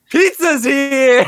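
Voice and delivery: high, loud, slow drawn-out call; excited Mikey. Retold or imitated. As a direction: announce the pizza like an overjoyed teen turtle. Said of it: "And then Mikey just goes".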